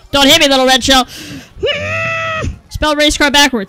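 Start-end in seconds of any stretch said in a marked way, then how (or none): 0.1-1.1 pleading yell at the shell; a much louder begging cry; loud plea. Acted. 1.1-2.6 a high strained cry; high yelp. Real, not acted. Reacts to a hit in the game.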